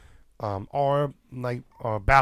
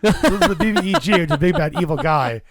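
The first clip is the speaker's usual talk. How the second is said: higher, louder grand reveal; loud announcement; 5 semitones higher, 7 dB louder than usual. Shown as a demonstration. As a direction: boom it out like a title. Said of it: "I messed up my own joke".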